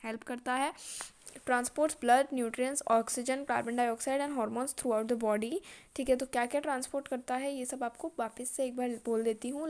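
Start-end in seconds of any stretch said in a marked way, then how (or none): 1.5-5.6 measured classroom dictation; teacher dictating notes word by word; slow dictation reading. Acted. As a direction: read it out slowly for notes.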